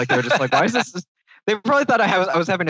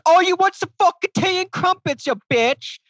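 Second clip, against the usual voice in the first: mock English accent. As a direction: sneer it in a fake posh English accent. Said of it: "I just made fun of her accent".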